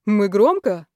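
loud, astonished question; surprised. A narrator's reading. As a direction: ask as if taken aback. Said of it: "удивлённый голос шефа".

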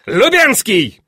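high, loud summons; imperious shouted name; calling out. A narrator's reading. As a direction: call for your aide across the hall. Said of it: "Опять позвал адъютанта великий князь".

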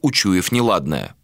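ordinary delivery

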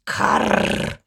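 rolling, rasping caw; crow's caw. A narrator's reading. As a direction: croak like a crow. Crow croaks in the story. (воронье карканье)

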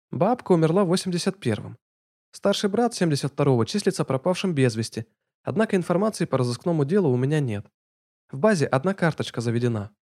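high brisk report voice; businesslike young woman. Acted. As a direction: read the facts briskly. Lana reports data.

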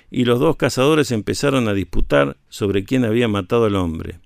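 ordinary delivery

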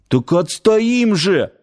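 high, drawn-out happy cry; joyful exclamation. A narrator's reading. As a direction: exclaim it with eager delight. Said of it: "радостно воскликнул Нанос".

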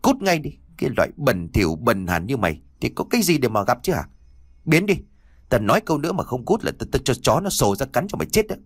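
high, sharp angry scolding; snapping angrily. Acted. Servant snapping at the boy to get lost, high and angry. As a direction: drive the boy off with a high snarl. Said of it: "lão liền gắt".

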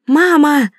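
put-on high, loud child's cry of mama; children shouting. A narrator's reading. Children shout to mom on the phone, loud and high. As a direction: call out mama like a delighted child. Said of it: "закричали оба".

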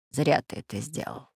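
soft, thoughtful murmur. A narrator's reading. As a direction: say it quietly, lost in thought. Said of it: "задумчиво произнёс профессор Пыхтелкин".

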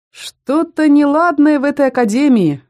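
bubbling, gurgling talking cauldron; gurgling pot voice. A narrator's reading. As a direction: gurgle the words like a boiling pot. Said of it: "пробулькал котелок". (булькающий голос котелка)

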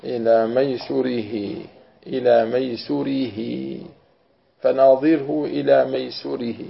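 ميسوره slowly, with "su" and a long final "hee"; variant reading demonstrated. Shown as a demonstration. Teacher modelling the connected reading, slow vowelled ميسوره. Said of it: "بضم السين وكسر الراء وإثبات الياء".

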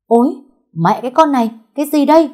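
loud, high-pitched cry of alarm; shocked outrage. A narrator's reading. Boy splashed with ink by a girl, shocked outrage. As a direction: burst out cursing, pitch shooting up.

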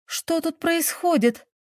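high, drawn-out startled question; alarmed exclamation. A narrator's reading. Girl coming downstairs finds strangers, exclaiming in surprise. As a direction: exclaim in puzzlement, pitched well up. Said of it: "на ходу восклицала она".

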